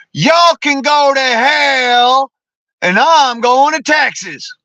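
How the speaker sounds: high, slow, drawn-out declaration; hammed-up defiant frontier hero; defiant Crockett quote. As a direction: play the frontiersman telling Congress off. Voicing Davy Crockett's defiant words.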